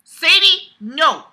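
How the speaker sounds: loud, high shout at the pet; loud, high scold; calling out. Real, not acted.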